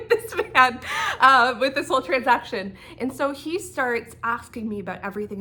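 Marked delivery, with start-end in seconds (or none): 0.1-2.8 laughing. Real, not acted. Laughs about the officer.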